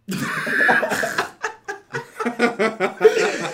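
long, high wheezing laugh; helpless laughter. Real, not acted. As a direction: lose it completely. Breaks up laughing.

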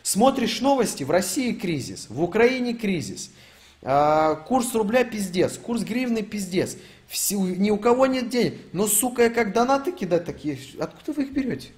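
high-pitched, baffled questioning; incredulous rant. Real, not acted. Streamer asking donors where the money comes from, high and incredulous. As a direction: pitch it up in real disbelief.

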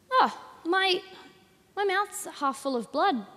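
chirpy high dying optimist. Acted. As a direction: cheerfully gasp through a mouthful of blood. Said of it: "That's a dying optimist".

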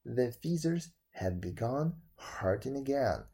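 ordinary delivery, nothing marked

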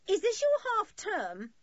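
very high, slow, loud call. A narrator's reading. Maggie questions the children from the boat, calling. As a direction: call across water in a high woman's voice. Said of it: "she called".

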